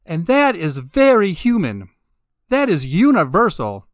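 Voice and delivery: usual speaking voice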